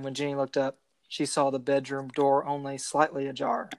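normal talking voice

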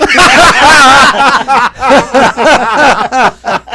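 loud high belly laugh; roaring laugh. Real, not acted. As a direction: burst out laughing hard. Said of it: "I'm roaring".

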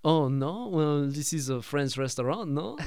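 imitated French accent, questioning lilt; Bruce Campbell. Maitre d' telling Peter it's a French restaurant, in a mock French accent. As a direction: play a hammy French maitre d'. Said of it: "he plays a French person very well".

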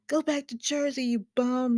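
mock a rude New York cab driver; New York cabbie. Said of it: "my New Yawk accent".

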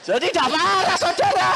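high, loud laughing exclamation; laughing loudly at his own story. Real, not acted. Preacher laughing at himself.

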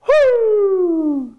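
long high "whoo" sliding downward; mock falling cry; falling whoop. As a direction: whoop as if plummeting. Imitates falling from the building.

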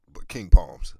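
loud hype call-out; loud plug. Real, not acted. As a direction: shout the brand name. Shouting out a product brand.

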